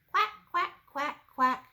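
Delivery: high, loud duck quacking; Mama Duck voice. Acted. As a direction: call out like a mother duck. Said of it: "Mama Duck said".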